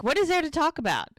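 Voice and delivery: dismissive retort. Retold or imitated. Podcaster voices man refusing to talk, exasperated. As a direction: fire back, refusing to discuss it.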